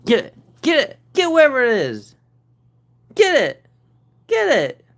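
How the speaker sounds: high, loud urging of the cat; excited cheering. Real, not acted. Owner cheering her cat to catch a bug, loud and excited. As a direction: egg the cat on to pounce.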